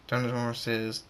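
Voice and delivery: usual speaking voice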